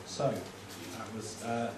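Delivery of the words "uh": ordinary talk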